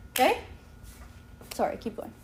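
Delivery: ordinary delivery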